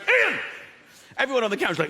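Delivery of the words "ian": high, loud bellow of a name; mock bellow. Dad on the train shouting to his son, loud. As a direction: roar the name down the phone. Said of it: "He went".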